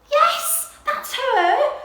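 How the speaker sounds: high, loud, thrilled cry; excitement. Acted. Little Owl recognising her mother's call, excited high cry. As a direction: burst out with joy. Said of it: "cried Little Owl in excitement".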